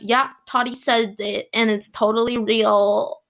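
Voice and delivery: ordinary delivery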